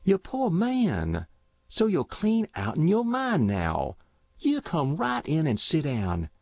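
high-pitched, motherly country woman; pitying neighbour woman. Acted. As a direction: fuss over a confused old man with pity.

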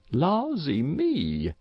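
slow, drawn-out countrywoman's lawsy; old wife's shocked outcry; dismayed exclamation. A narrator's reading. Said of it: "exclaimed Mrs".

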